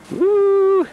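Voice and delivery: high loud joyful whoop. Real, not acted. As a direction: let out a big excited woo. Whooping outside in snow.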